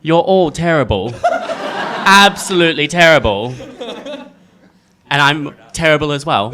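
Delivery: loud, mock-scolding British accent; hammed-up British scolding, amused. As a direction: declare it in an exaggerated British accent. Joins in the British accent task.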